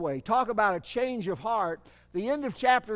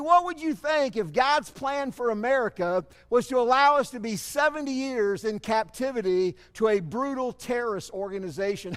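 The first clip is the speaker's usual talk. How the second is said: ordinary delivery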